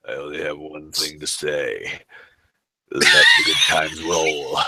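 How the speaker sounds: low, slow, ominous wrestler voice; Undertaker. Acted. Quoting a wrestler closing a press conference, low solemn voice. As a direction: intone it low and slow, drawing words out. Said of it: "he closed it by saying".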